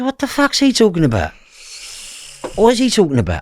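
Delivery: high-pitched incredulous outburst; retold outraged question; outraged disbelief. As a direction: demand to know, voice shooting up. Retells outrage at father's remark.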